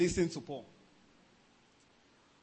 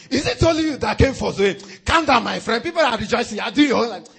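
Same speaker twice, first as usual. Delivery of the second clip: scolding crowd; 3 semitones higher, 7 dB louder than usual. Retold or imitated. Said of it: "Men shouted him down".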